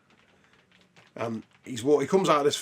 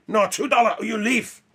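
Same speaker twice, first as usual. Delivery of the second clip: high, loud mock shout in a Chinese shopkeeper's accent; hammed-up Chinese shopkeeper yell; 9 semitones higher, 5 dB louder than usual. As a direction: refuse the money hard, in a Chinese shopkeeper's accent. Shopkeeper refusing, retold scene.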